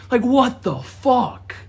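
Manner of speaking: stunned by the story; stunned disbelief. Real, not acted. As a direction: drag it out in shock. Shocked reaction repeated.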